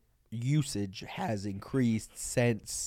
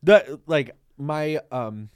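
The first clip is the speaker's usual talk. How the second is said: normal talking voice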